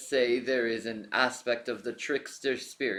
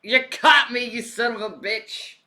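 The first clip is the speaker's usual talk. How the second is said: gleeful mock southern accent; 8 semitones higher, 9 dB louder than usual. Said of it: "putting a southern accent on him".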